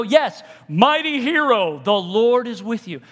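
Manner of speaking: slow, loud, emphatic herald; booming insistence; insistent proclamation. Acted. Angel insisting.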